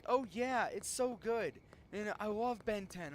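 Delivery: high-pitched mock enthusiasm; put-on excited fan voice; imitated gushing fans. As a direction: gush like a devoted fan, mockingly.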